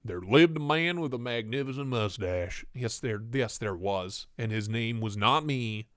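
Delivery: low Southern twang storytelling. Acted. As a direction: read the tale with a Southern twang. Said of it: "I need twang".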